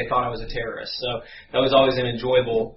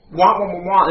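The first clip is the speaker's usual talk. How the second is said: louder, higher wah-wah drone; Charlie Brown's teacher; 4 semitones higher, 5 dB louder than usual. Retold or imitated. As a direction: imitate preaching as wordless trombone noise. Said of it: "He sounded like Charlie Brown's teacher".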